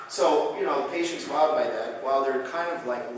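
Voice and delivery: ordinary delivery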